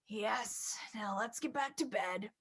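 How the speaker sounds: low, soft father voice; a gentle low dad tucking in his child; reading the father's lines low and soft; papa voice. Acted.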